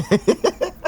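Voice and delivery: high-pitched laugh into a word. Real, not acted. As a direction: crack up mid-sentence. Laughing at own retelling.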